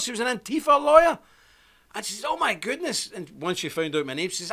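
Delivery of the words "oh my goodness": high-pitched imitation of an astonished woman; amazed reaction. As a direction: mimic her delighted astonishment.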